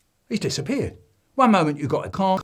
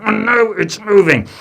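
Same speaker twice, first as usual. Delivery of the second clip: grunting; 6 semitones higher, 12 dB louder than usual. Acted. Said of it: "I'm grunting back at him".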